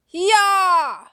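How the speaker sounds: very high, loud attack screech. Acted. The Rat Man screeching as he swings his sword, very high and loud. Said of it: "the Rat Man screeches".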